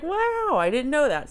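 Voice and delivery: high-pitched retold amazement; amazed wow. Telling viewers about the discovery, playfully amazed. Retells reaction to surprising fact.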